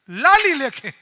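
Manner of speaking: both words thrown out loud, the proverb hammered home; emphatic repeat. Real, not acted. Preacher shouting the proverb લાલી લેખે back at the crowd.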